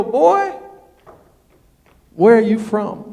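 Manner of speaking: very loud, drawn-out challenge; mock challenge. Texans look at the newcomer and ask, booming.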